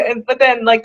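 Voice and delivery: ordinary delivery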